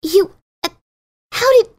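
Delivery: startled, stumbling stammer rising high; stuttering. Acted. Caught off guard by the old nickname, stammering. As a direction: trip over the words in shock. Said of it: "she stuttered".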